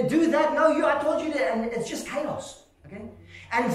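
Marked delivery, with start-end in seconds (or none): 0.0-1.6 higher, lightly acted bickering; mock argument in a raised pitch; squabbling brothers. Brothers squabbling in the storm, mock bickering. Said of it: "the two brothers are fighting".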